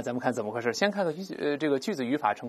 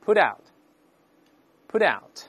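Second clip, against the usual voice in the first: faster read; 3 semitones higher, 3 dB louder, much slower than usual. Shown as a demonstration. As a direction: put out, put out linked, the T lightly voiced. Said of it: "再读快点".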